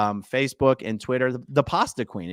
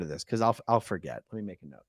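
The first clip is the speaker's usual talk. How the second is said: muttering to self; 6 semitones lower, 7 dB quieter, faster than usual. Real, not acted. Muttering to herself.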